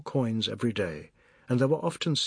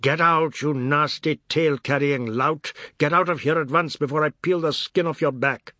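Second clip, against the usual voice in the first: loud, harsh threatening command; furious order; 2 semitones higher, 5 dB louder than usual. Acted. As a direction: drive him out with rage. Bandat throwing boy out.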